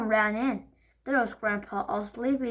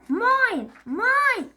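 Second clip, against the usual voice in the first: high, loud shrieks; shrieking mine; 12 semitones higher, 7 dB louder than usual. Acted. Henry shrieking in the fight over the seat. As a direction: claim it at full volume.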